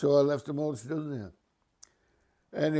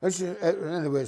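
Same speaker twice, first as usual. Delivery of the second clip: ordinary delivery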